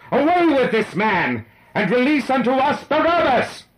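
very high, full-voiced mob shout; crying out. A narrator's reading. The crowd answers Pilate's question, crying out in a high shout. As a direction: yell the demand at full pitch. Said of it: "they cried out again".

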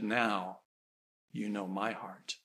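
very low, soft divine voice; inner voice. Retold or imitated. God speaking in the waking mother's head, hushed. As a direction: say it hushed and slow-falling, inside her head. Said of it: "a voice in her head".

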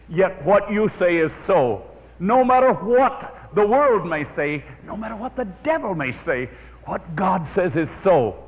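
a preacher modeling the believer's prayer, fervently; declaration of faith. Acted. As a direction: proclaim it with rising conviction.

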